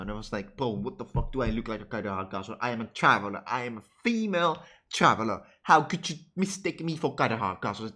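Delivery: usual speaking voice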